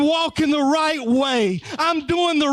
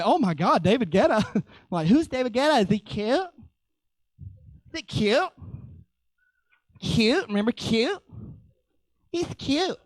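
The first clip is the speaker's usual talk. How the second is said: imitating Kristen; 3 dB quieter, faster than usual. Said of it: "Kristen told me".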